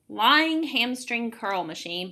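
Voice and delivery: ordinary delivery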